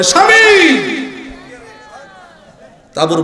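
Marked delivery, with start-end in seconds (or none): 0.0-0.7 high, ringing call to a husband; shouted wife's call, pitched way up; acted scream. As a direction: cry out suddenly from inside the tent. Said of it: "একটা চিৎকার দিয়ে বলে".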